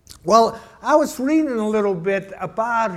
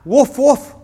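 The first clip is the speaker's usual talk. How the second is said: two higher, louder woofs in a doggy voice; double dog bark; 5 semitones higher, 6 dB louder than usual. Retold or imitated. Dog ordering two pounds from the butcher, double bark. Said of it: "how many pounds you want?".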